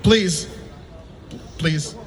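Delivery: normal talking voice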